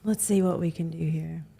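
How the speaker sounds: ordinary talk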